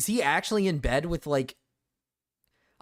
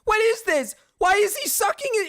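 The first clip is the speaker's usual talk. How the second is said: loud, higher, disbelieving outburst; shocked yelling; 6 dB louder than usual. Real, not acted. Yelling at the video in shock. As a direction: yell at the screen in disbelief.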